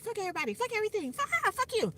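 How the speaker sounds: high, rising cussing-out rant; mock angry rant. Venting at family before cutting contact, rising angry rant.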